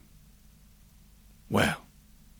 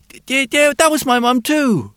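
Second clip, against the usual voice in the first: put-on high, loud, hesitant child; little boy voice; 12 semitones higher, 6 dB louder than usual. A narrator's reading. Boy's voice in story.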